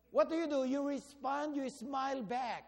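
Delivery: ordinary delivery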